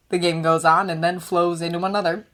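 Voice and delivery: ordinary delivery, nothing marked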